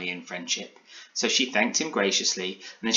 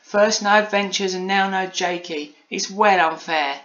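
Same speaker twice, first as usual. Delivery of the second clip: put-on high childish grumble, slowing at the end; Polly sighing complaint; 10 semitones higher, 6 dB louder than usual. A narrator's reading. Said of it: "sighed Polly with a sigh".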